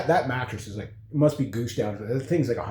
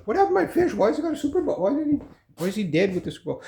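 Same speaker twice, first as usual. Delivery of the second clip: shocked owner voice; 10 semitones higher, faster than usual. Retold or imitated. Voicing the fish's owner finding it.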